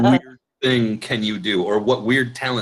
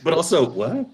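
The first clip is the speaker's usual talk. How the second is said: laughing; 7 semitones higher than usual. Real, not acted. Laughing in amazement at the trick.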